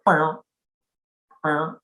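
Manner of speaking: short plucked "Bow" green frog imitation; banjo twang frog call. Shown as a demonstration. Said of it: "sounds like a banjo string twang".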